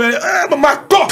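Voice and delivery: very high exclamation in a host impression; Antônio Carlos. Radio host reacting to the snippet, high-pitched exclaimed impression. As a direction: burst out high in the put-on voice. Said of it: "Faz um trechinho aí".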